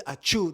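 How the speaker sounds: a quick mock "achoo" sneeze sound; imitated sneeze. Shown as a demonstration. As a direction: demo a regular sneeze, lightly. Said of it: "This is not an achoo sneeze".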